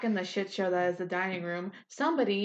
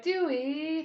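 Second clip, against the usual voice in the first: pleading call; 8 semitones higher than usual. Retold or imitated. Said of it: "everyone was just like".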